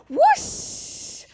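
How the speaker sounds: high whispered whoosh; wind whoosh. A narrator's reading. Hurricane returning, high whispered wind whoosh. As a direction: blow it like wind.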